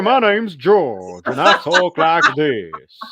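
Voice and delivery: booming Southern US accent, slow and high; hammed-up Southern character voice, drawn out; George. Shown as a demonstration. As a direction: put on an absolutely terrible Southern drawl. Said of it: "It was my voice for George".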